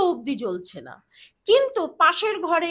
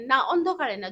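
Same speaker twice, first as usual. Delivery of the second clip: ordinary talk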